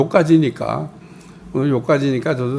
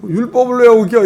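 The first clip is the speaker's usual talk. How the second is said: 높고 크게 따져 묻듯; 유대인들이 나무라듯 쏘아붙여; 따지는 말투; 7 semitones higher, 4 dB louder than usual. Retold or imitated. 유대인들의 비난을 흉내냄. (유대인들이 베드로를 나무라며 따지는 말투)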